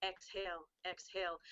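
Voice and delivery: a calm guiding exhale, exhale; slow soft exhale cue. Shown as a demonstration. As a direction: say exhale, exhale softly and low. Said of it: "you know what?".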